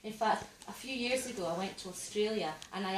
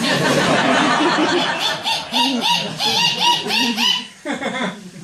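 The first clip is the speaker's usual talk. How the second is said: very high, loud imitated dolphin cackle; long repeated squeals like a jeering dolphin; mocking dolphin laugh; 17 dB louder than usual. Comic imitating the dolphin laughing in her face, mocking squeal. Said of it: "it laughed in my face".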